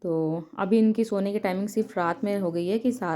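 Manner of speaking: normal talking voice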